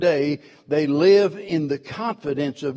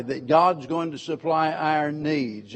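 normal talking voice

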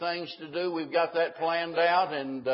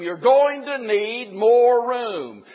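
emphatic preaching; 6 semitones higher, 8 dB louder, slower than usual. Acted. Preacher emphasizes need for room.

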